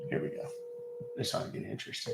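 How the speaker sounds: hushed whisper to himself. Real, not acted. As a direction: breathe it out under his breath. Anticipating the scam call.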